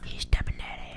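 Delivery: whispered. Real, not acted. Teasing whisper during card game.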